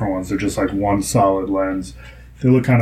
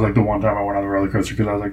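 usual speaking voice

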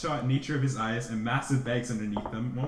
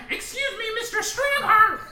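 high, loud cheery scout leader; rally the troop onward; scoutmaster voice; 9 dB louder than usual. Acted. Scoutmaster to his scout, a high hearty rally.

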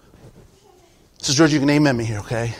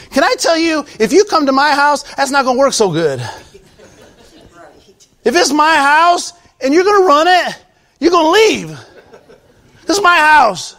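higher, loud sermon rebuke of grumblers with shouted peaks; fiery preaching; 13 semitones higher, 5 dB louder than usual. Acted. Pastor scolding a grumbling congregation, loud fiery preaching. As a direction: preach hard at the complainers, voice lifted.

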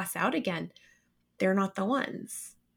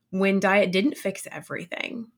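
ordinary delivery

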